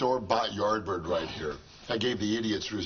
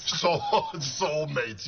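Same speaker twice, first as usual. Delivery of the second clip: laughing; 6 semitones higher, 3 dB louder, much slower than usual. Real, not acted. Mocking romantic notions, laughing.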